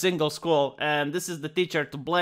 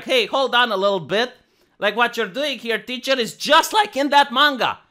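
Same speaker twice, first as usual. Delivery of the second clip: higher, louder indignant student voice; student calling out teacher; 6 semitones higher, 3 dB louder than usual. Retold or imitated. Student confronting a teacher in class, higher indignant protest. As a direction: call the teacher out, voice rising. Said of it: "go to a teacher in a real classroom and be like".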